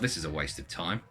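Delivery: low, soft inner-thought aside; thinking to oneself. A narrator's reading. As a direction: say it under your voice, to yourself. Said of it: "I thought to myself".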